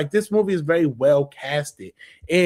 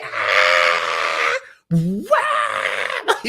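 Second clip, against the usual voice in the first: shouted Yzma yelp; 9 semitones lower than usual. Retold or imitated. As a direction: yelp like a cat bouncing off a trampoline. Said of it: "she's like".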